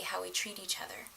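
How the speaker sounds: hushed whisper; whispered sincerity. Real, not acted. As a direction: drop to a whisper to close it. Heartfelt point about love.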